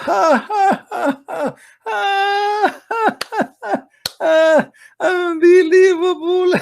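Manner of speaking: very high-pitched, drawn-out mocking cackle; squealing cartoon devil laughter; mock devil's laugh. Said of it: "he laugh".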